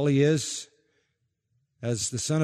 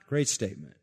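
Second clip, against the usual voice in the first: usual speaking voice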